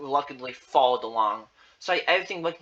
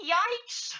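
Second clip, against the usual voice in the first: very high-pitched little yelp; mock fright. Reader reacting to giant claws, mock fright. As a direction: squeak it out in pretend alarm.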